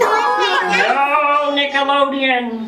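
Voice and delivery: slow, drawn-out teasing build; slow mock-solemn countdown; dramatic slow list. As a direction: stretch each item out to get a No from the kids. Teasing kids about Antarctica.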